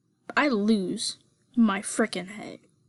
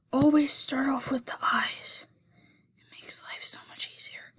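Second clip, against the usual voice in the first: whispering; 2 semitones higher, 5 dB quieter than usual. Real, not acted. Whispered drawing tip aside.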